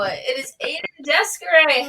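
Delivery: usual speaking voice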